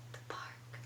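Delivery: very soft, voiceless whisper. Shown as a demonstration. Teacher telling the puppet her place, whispering. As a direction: whisper it like a secret. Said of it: "or speak it".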